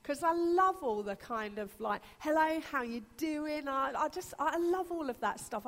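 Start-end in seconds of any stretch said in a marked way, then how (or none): 2.2-3.7 cheery American greeting. Retold or imitated. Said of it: "I'd quite like to be American".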